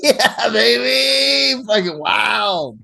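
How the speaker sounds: high, slow drawn-out cheer, still laughing; excited whoop. Real, not acted. Friend hyping the crew about living on the edge, drawn-out whoop. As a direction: stretch it out like a happy howl.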